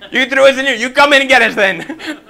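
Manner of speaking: loud, high, indignant comeback; mock cocky defiance; arrogant retort. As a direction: throw it back at the king, half laughing. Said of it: "They weren't arrogant".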